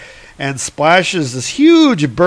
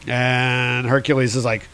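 ordinary delivery

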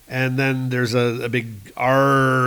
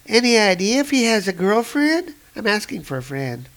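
high female voice; 10 semitones higher than usual. Acted. Reporter asks about Thor.

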